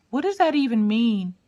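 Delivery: put-on high child voice; high-pitched mock bewilderment; baffled kid.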